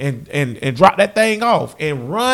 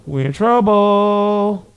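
drawn-out lament; 3 semitones higher, much slower than usual. Real, not acted.

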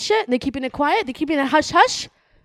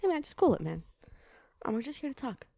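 friendly cop, much softer and chummy; soft good cop; 3 semitones lower, 10 dB quieter than usual. Acted. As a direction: win him over gently like a buddy.

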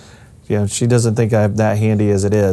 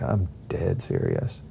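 slow, softer, earnest retort; dead serious; 2 semitones lower, 9 dB quieter, slower than usual. Retold or imitated. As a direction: say it slowly and quietly, meaning every word. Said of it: "You're serious?".

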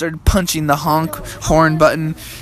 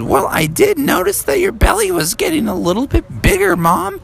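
put-on high-pitched kid frog; Froggy. Froggy remarks on mom's belly, high child voice. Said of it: "And then he said".

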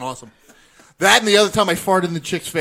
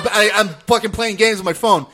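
ordinary talk, nothing marked

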